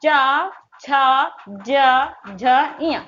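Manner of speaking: चा, छा, जा, झा very slow, long vowels; articulation demo. Shown as a demonstration. Teacher introducing the चा वर्ग, चा, छा, जा, झा drawn out. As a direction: hold the vowel in चा, छा, जा, झा, then इया. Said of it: "चा वर्ग की मैं बात करूँ".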